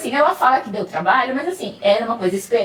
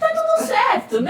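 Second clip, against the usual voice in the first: exaggerated reassurance. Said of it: "eu falo".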